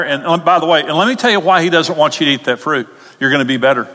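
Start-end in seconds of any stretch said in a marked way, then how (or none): none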